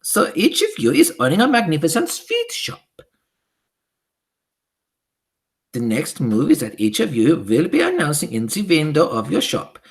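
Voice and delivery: high, loud witch voice with a foreign lilt; high imperious witch decree; Grand High Witch. Acted. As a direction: command the witches like a tyrant queen. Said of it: "cried out".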